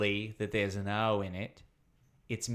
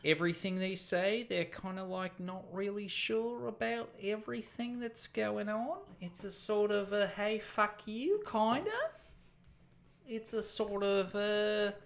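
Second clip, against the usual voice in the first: high, tentative Kiwi accent, rising endings; shy New Zealand accent; 8 semitones higher, 5 dB quieter than usual. Shown as a demonstration. Comedian demonstrating a shy Australian, hesitant New Zealand accent. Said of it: "New Zealand accent is a shy Australian".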